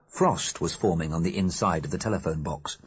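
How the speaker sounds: plain reading voice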